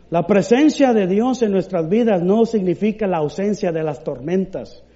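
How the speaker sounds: ordinary delivery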